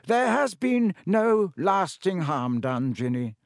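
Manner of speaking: put-on slow, gentle old wizard reassurance; calm the frightened girl slowly; Dumbledore reassuring. A narrator's reading.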